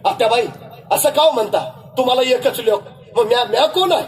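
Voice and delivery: resolute wife. Acted. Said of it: "बायको म्हणाली".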